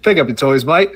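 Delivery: put-on jokey English accent sliding into Australian. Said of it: "English Australian accent".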